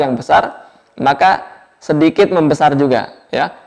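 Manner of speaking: ordinary talk, nothing marked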